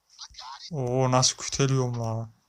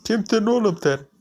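indignant; 8 semitones higher, 4 dB louder than usual. Real, not acted. Indignant at game character.

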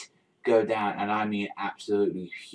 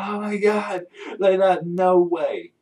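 high, stunned fan scream; 9 semitones higher, 6 dB louder, slower than usual. Retold or imitated. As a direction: shriek it in disbelief, slowing at the end. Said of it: "I'm fucking screaming".

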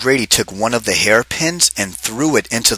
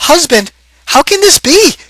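ordinary delivery

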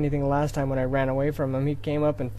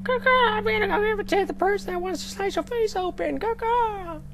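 high, squawky crow impression; mock the crow as a snitch. Gamer imitating the crow betraying him, squawking.

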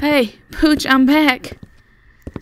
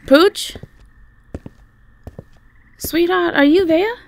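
calling out; 8 semitones higher, 4 dB louder than usual. Acted. Calling for missing pet.

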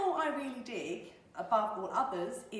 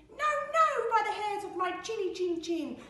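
very high, loud piglet refusal; frightened little pig; 11 semitones higher, 7 dB louder than usual. Acted. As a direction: squeal it out like a defiant piglet.

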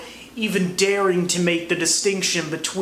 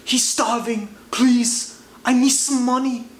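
a hustler hamming up his sob story; high whiny mock begging; begging vendor; 6 semitones higher than usual.

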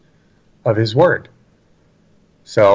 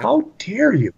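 higher-pitched mock outrage; imitated indignation; 10 semitones higher than usual. Offended critics scolding a blunt preacher, mock indignation. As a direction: act out an offended objection, pitched up.

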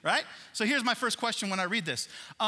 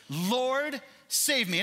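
pleading cry; 7 semitones higher, 4 dB louder, much slower than usual. Retold or imitated. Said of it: "I have said this very phrase".

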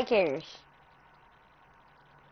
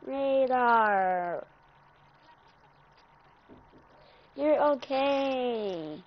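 softer, slow and soothing; calm the crying dog down gently; soft reassurance; 5 dB quieter, much slower than usual. Real, not acted. Owner soothing crying dog Radar, soft and slow.

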